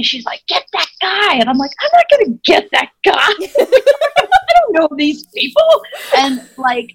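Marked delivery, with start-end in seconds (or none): 0.5-1.3 high-pitched mock yell, no real volume; pitched-up imitation of someone yelling; retold yell. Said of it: "And she's yelling at me". 1.8-5.6 high, laughing, drawn-out objection; imitating your own indignant refusal, pitched way up; retold incredulous protest. Retold refusal of a yelled order.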